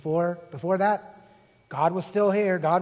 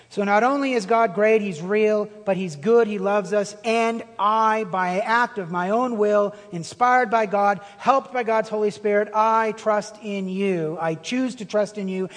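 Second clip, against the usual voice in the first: fervent preaching; 4 dB louder than usual. Acted. Preaching at full force.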